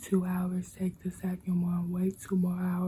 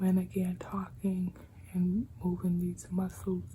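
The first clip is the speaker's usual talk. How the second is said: normal talking voice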